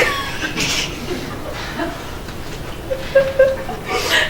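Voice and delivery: laugh. Real, not acted. Laughs at own joke.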